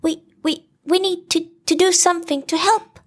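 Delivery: high, fast, stammering little pig; Piglet voice. A narrator's reading. Piglet anxiously urging the friends to help, high and stammering. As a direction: rush it high, tripping over words.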